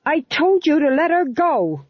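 a small man past all fear; angry. Acted. The fool confronts the guards, furious and loud. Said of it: "bravery of the terminally angry".

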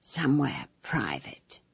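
slow, hushed secret whisper; breathy whispered aside; conspiratorial whisper. A narrator's reading. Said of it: "added with a conspiratorial whisper".

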